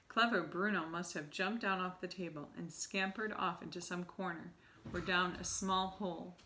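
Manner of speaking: ordinary delivery, nothing marked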